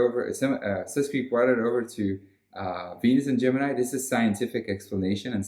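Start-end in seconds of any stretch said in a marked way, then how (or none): none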